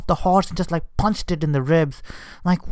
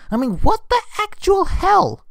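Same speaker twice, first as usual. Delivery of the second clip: high-pitched, exasperated what the hell; exasperated disbelief. Real, not acted. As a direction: throw your voice up in outraged disbelief. Ranting about jockey punching horse.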